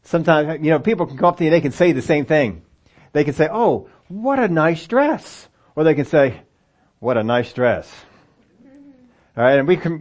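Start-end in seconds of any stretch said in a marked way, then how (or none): 3.5-5.5 high, slow, admiring rise on dress; sincere compliment. Shown as a demonstration. Preacher demonstrating a genuine compliment to the congregation, high and slow. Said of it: "you don't get all the voice inflection". 7.0-7.9 lower, flat, dismissive fall from What; sarcastic. Shown as a demonstration. Preacher showing the snide version of the same compliment, low and dry. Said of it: "we said the same thing".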